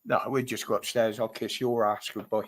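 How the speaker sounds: normal talking voice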